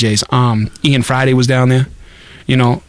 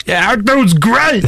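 higher, slow tipsy praise; drunk praise; 6 semitones higher, slower than usual. Retold or imitated. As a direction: gush like a tipsy guy. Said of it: "And I'm like".

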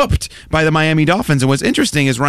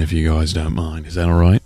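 lower-pitched put-on New Zealand accent; 10 semitones lower than usual. Asking the co-hosts in a New Zealand accent. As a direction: lay on a Kiwi accent for the show. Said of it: "I might just do the entire rest of the show in a New Zealand accent".

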